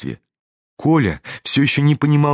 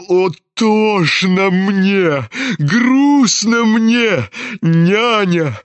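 very slow, drawn-out lament; high, stretched theatrical moan; wailing old general; 9 semitones higher, 3 dB louder, much slower than usual.

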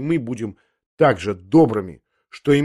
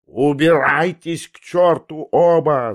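high, slow, drawn-out angry yell; furious yell; 4 semitones higher, 4 dB louder, slower than usual. Acted. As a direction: yell them away in a rage. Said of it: "завопил Ламберт".

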